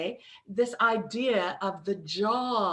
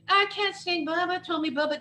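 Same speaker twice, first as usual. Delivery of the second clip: higher voice of a moaning inner critic; whiny inner critic; 7 semitones higher than usual. Retold or imitated. The inner critic saying you can't sing, whiny. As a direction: whine like a self-doubting inner voice. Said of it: "inner critics that's going".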